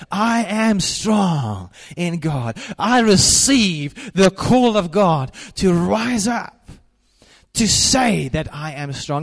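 loud, high, slow proclamation; bold declaration. Acted. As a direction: proclaim it with rising fervor. Preacher voicing bold self-declaration.

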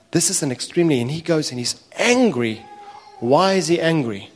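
ordinary delivery

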